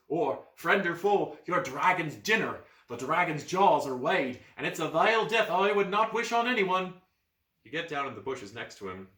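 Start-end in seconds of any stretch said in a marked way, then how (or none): 0.1-7.0 urgent warning. Acted. Boy warning of dragon.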